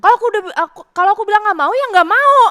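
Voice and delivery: put-on high, loud childish snap; loud bratty refusal; retold snapping. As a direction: shout it back like a stubborn kid refusing. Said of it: "Terus aku membentak".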